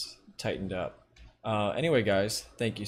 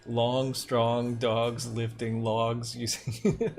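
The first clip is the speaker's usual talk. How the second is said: very slow, drawn-out Long Island vowels; Long Island accent; much slower than usual. Shown as a demonstration. Streamer reading a viewer's comment aloud, Long Island accent. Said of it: "the most Long Island thing ever".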